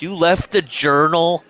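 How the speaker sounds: loud, high-pitched, drawn-out outburst; all caps shout. Acted. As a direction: read it out as if it's all capitals. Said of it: "just wrote in all caps".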